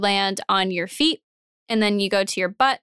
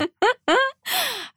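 goose honk. Pretend. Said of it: "That's a terrible goose impression".

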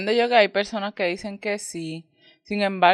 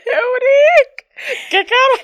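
excited shriek; 9 dB louder, slower than usual. Real, not acted. Cohost reacting to the impression.

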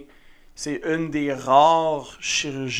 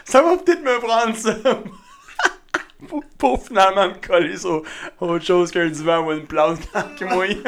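laughing; 4 semitones higher, 4 dB louder than usual. Real, not acted. Laughing at own joke.

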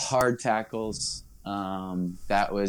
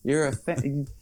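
heated scolding; 8 semitones higher than usual. Retold or imitated. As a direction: tell the fan he's got it wrong. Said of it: "I'm like".

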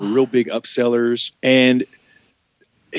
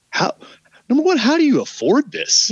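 much higher, disbelieving; incredulous; 14 semitones higher than usual. Retold or imitated. Waitress wondering at the customers' huge bills, high incredulous. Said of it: "You're just like".

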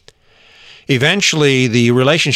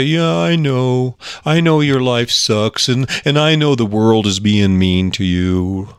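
slow, drawn-out mock sympathy; coddling enabler; slower than usual. Enabler swooping in to soothe the complainer, coddling. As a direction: stretch the words in exaggerated commiseration. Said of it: "The enabler will always swoop in and say".

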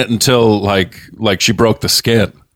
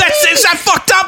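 squeaky high-pitched mock voice; shrill mocking impression; mock high kid voice.

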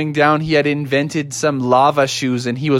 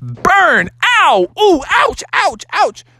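high, quick cartoon yelps; acted pain yelps; 12 semitones higher, slower than usual. Bear stepping on hot lava, yelping in pain. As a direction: yelp as if your foot just got burned.

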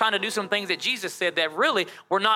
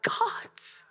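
breathy whisper, barely voiced; whispered answer; 6 dB quieter than usual. Real, not acted.

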